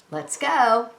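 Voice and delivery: usual speaking voice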